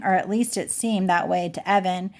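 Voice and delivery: ordinary delivery, nothing marked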